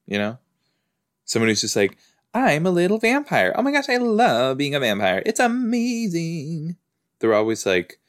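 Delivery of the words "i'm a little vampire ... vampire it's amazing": high, gushing, bubbly vampire; happy vampire. Acted. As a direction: gush in a high voice, drawing out the ending. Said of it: "like, happy vampire".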